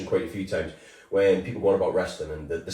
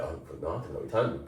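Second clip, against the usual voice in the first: quick, much softer mumbled denial; a younger self hiding his love of wrestling; embarrassed denial; 7 semitones lower, 11 dB quieter, much faster than usual. Retold or imitated. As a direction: brush it off fast and under your breath. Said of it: "I'd be like".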